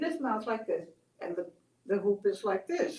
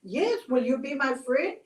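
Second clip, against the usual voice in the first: higher, louder eager outburst; all excited; 4 semitones higher, 5 dB louder, slower than usual. Acted. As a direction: act a lonely character thrilled to find a friend. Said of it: "Cactus gets all excited".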